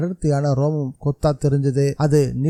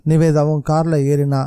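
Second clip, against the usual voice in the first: usual speaking voice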